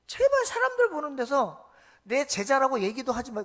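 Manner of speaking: exasperated plea. Retold or imitated. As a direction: push 제발 hard and high. Jesus voiced exasperated. (제발을 세게 힘주어 애원)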